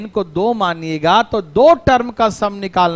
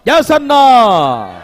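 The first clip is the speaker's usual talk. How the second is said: drawn-out yes or no; 5 dB louder, much slower than usual. Real, not acted. Teacher demands answer from class.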